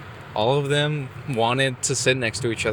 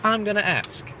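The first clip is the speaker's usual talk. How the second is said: determined inner vow; 11 semitones higher than usual. Retold or imitated. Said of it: "but I was like".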